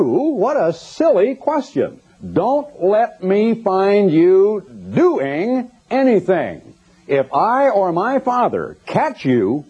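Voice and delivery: a hint of a condescending preacher, slow; mock patronizing Jesus. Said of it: "patted him on the head and said".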